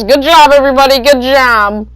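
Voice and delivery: mock a fake-cheerful Karen teacher. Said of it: "like a Karen she is".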